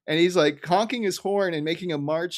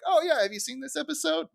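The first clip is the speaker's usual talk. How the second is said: high chirpy quote; eager fan; 13 semitones higher, 4 dB quieter than usual. Retold or imitated. A touch of an eager fan asking about the episode. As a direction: ask it brightly. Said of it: "when someone's like".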